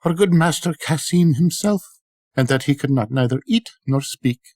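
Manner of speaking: a hint of a sorrowful servant girl; sighing servant. A narrator's reading. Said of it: "She replied with a sigh".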